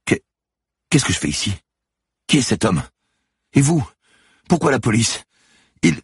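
high, loud, frantic questions; bewildered and frightened, pitch way up; panicked confusion. A narrator's reading. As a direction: fire questions in rising panic. Manon disoriented, panicking after attack.

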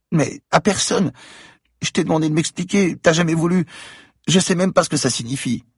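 put-on high child voice, telling a secret; little girl voice. A narrator's reading. Girl tells Franck about the voices, high child voice. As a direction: voice a scared child, high-pitched.

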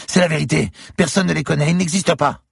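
very high, loud pleading; panicked insistence. A narrator's reading. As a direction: desperately insist on being believed.

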